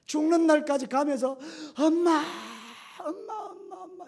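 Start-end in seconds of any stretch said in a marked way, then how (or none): 1.8-4.0 crying out for mother. Retold or imitated. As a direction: wail it like a condemned man dragged to his death. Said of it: "제일 많이 부르는 이름이 어머니래요".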